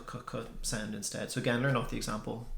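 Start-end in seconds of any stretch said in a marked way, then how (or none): 0.1-0.9 "k" as a crisp unvoiced click, no G voicing; devoiced k sound. Shown as a demonstration. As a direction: clip the "k, k" sharp and breathless. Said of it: "it kinda makes this k".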